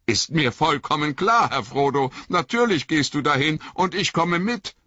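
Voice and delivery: high, hurt, shivering reproach; a wet hobbit stung by his master; Sam, cold and soaked, reproaching Frodo in a high voice. Acted.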